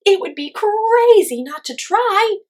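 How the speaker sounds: very high, loud, slow shout. A narrator's reading. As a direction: call it out bravely from far above. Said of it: "shouted Calliope".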